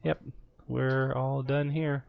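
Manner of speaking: slow spooky voice; slow and eerie; creepy voice. Acted. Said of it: "Get away from the creepy voice".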